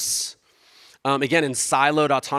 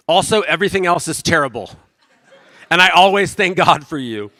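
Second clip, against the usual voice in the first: laughing; 5 semitones higher, 7 dB louder than usual. Retold or imitated. Paraphrasing Paul with comic twist.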